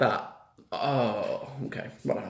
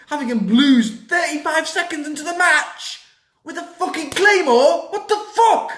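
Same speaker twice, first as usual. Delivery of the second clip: angry rant at W W E building to an outraged peak; retold furious rant; 7 semitones higher, 4 dB louder than usual. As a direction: rant that builds from angry to a much higher, louder outburst. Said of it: "I was like".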